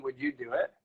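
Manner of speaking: ordinary delivery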